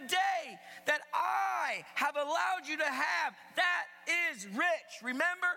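high, forceful preacher speaking as God; emphatic divine rebuke, punching the key words; voicing God. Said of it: "He's saying this".

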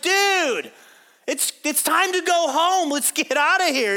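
high-pitched acted call across the water; yelling at the guys. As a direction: holler to your buddies far off. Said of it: "he yells at the guys".

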